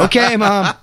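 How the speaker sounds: said through a laugh. Retold or imitated. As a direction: laugh while giving in. Mock agreeing with mother, laughing.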